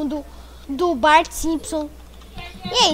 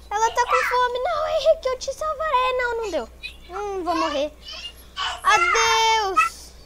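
mock despair; 9 semitones higher than usual. Dying in game, lamenting.

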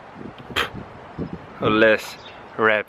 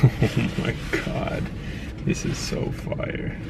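breathless whispered amazement; hushed awe; 6 dB quieter, slower than usual. Real, not acted. Amazed at hoodie's back.

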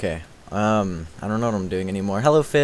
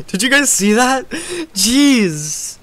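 high, laughing excited disbelief; 13 semitones higher, 5 dB louder, much slower than usual. Real, not acted. Asking chat if they saw the play, excited laughing.

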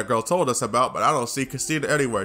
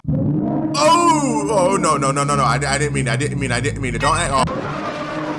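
panicked; 4 dB louder than usual. Real, not acted. Gamer protesting he didn't mean it, panicked. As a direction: blurt a drawn-out oh, then stammer out denials.

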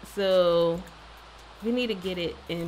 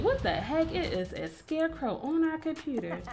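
mock baby voice; 8 semitones higher than usual. Voicing the baby's reaction.

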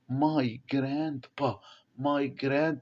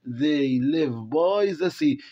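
slow reading aloud; 3 dB louder, much slower than usual. Shown as a demonstration. Teacher reading the text to the class, very slowly. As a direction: read each English word very slowly for the class.

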